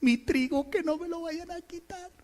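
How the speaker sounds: high, thin timid whimper; a hammed-up coward clutching his possessions; fearful Gedeón. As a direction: fret softly in a high, scared voice.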